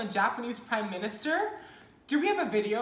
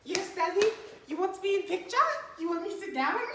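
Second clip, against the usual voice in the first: lightly voiced young woman, high and quick; Monica; 7 semitones higher, 3 dB louder, faster than usual. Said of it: "Hey, Monica".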